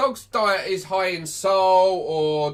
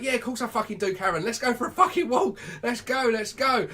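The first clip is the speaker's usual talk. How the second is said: higher-pitched, over-eager dog talking; excited dog's voice; 6 semitones higher than usual. Acted. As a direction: play a dog bursting to get out. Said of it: "And the dog's like".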